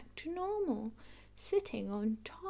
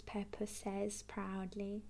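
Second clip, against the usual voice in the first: plain reading voice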